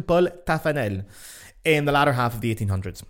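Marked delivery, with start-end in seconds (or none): 0.0-1.0 Paul Taffanel in French pronunciation; short French vowel in Paul, Taffanel evenly stressed; French version. Shown as a demonstration. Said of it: "French version".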